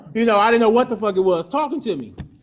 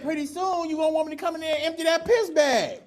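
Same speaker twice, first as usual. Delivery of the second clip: higher, shouted nurse threat; mock nurse yell; 9 semitones higher, 3 dB quieter than usual. Fed-up nurse threatening patient, mock shout.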